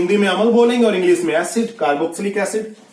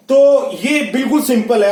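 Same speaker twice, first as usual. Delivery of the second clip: ordinary delivery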